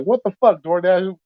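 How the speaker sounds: high, exasperated mock yell at the phone. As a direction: snap at the phone in disbelief. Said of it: "I'll be yelling at the phone".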